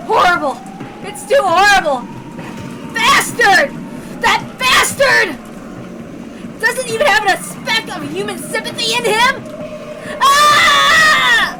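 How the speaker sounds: loud, high shouting that ends in a scream; enraged Battler screaming at the killer; furious shout. Acted. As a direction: curse the killer with all your rage.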